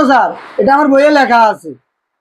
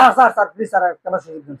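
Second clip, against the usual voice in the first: meek begging, dropping softer and lower; pleading; 4 semitones lower, 4 dB quieter, faster than usual. Acted. Trainee asking sir for one more chance, pleading. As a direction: beg the boss, voice sinking away.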